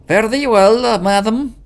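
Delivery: high, loud mock-Scottish farewell; fake Scottish accent. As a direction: lay on a silly Scottish lilt. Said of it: "stupid Scottish accent as well".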